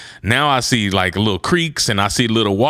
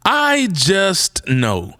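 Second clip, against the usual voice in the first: very slow, higher "I just know"; drawn-out disbelief; 5 semitones higher, much slower than usual. Retold or imitated. Hiker refusing to believe the guide, incredulous drawl. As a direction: stretch every word in mock disbelief.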